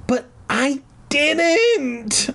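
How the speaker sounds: put-on high, very slow girlish shriek of denial. A narrator's reading. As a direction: wail the denial like a wronged kid. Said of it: "I shrieked".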